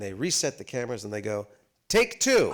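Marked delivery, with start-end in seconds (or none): 1.9-2.5 high, loud crew call; called-out film direction. Retold or imitated. Film crew calling freezing actors back, high loud call. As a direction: sing it out across the set.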